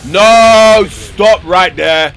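loud, high, stretched-out yell; real panic as the enemy closes in; desperate shout. Real, not acted. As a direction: scream at the enemy to halt.

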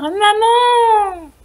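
high drawn-out call; boy calling long for mum; scared child's cry. A narrator's reading. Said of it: "a encore plus peur".

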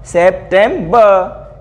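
September drawn out very slowly as sep-tem-ba; very slow model word. Shown as a demonstration. Teacher slowly models September twice for children. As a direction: stretch September out, each syllable slowly. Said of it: "तो क्या हो गया हमारा?".

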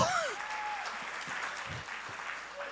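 high joyful laugh. Real, not acted. Pastor laughing with the cheering crowd, joyful laugh. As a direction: burst out laughing with joy.

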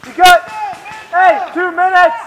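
loud, high callout, fading slightly; shouted time warning. Real, not acted. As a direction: bellow the time left across the field. Calling time to teammate.

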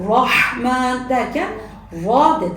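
Rahman with a slow, full ra; thick ra. Shown as a demonstration. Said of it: "dolu dolu bir ra harfi".

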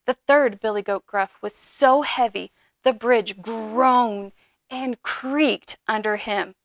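ordinary delivery, nothing marked

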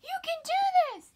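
high, loud squealing cheer; excited fan shout. Retold or imitated. Friend in the crowd cheering the runner on, squealing loud and high. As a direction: shriek it like a thrilled young fan. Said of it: "the girl's like".

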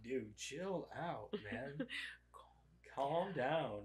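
slow, low, soft retold plea to settle down; weary dad calming. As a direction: imitate a dad quietly talking a kid down. Dad voiced telling annoyed kid.

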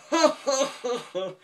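loud, high mock laughter; hammed-up cartoon guffaw, far above your own voice; imitated manic laugh. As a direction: imitate a man laughing wildly. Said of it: "the guy is just laughing".

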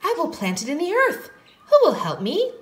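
high storybook hen voice; little red hen. A narrator's reading. Hen asks friends to help plant, high character voice. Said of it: "she said to the friends".

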